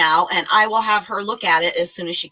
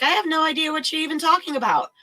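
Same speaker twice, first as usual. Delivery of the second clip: clueless receptionist; 8 semitones higher, faster than usual. Retold or imitated. Receptionist voiced in retold call.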